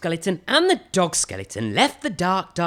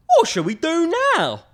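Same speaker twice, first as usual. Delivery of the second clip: much higher, louder storybook voice for the big skeleton; 13 semitones higher, 5 dB louder than usual. Acted. Big skeleton asks his friend what next, higher voice. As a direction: play the big skeleton, bright and puzzled.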